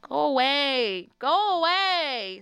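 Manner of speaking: long, drawn-out rising wail; exasperated dismissal. Pretend. Traveler shooing off the intrusive stranger, exasperated drawl. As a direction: stretch each word slowly, high and loud.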